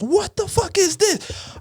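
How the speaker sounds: high-pitched, drawn-out mock yell. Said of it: "yells at me like".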